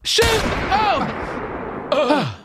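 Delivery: high, overwrought captive's plea; hammed-up high-pitched death scene; shot captive. Captive gasping to the one who shot him, hammed-up.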